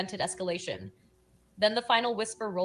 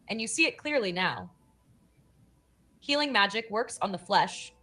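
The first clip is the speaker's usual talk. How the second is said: ordinary talk, nothing marked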